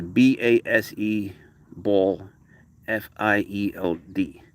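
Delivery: slow covert spelling; spelled out slowly. Real, not acted. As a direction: spell it so the dog won't catch on. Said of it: "I don't wanna say it".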